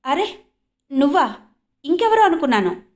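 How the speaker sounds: surprised. A narrator's reading. Jyoti spotting Sandhya in the room, surprised. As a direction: act amazed at seeing her. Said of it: "ఆశ్చర్యం వెలుబుచ్చింది జ్యోతి".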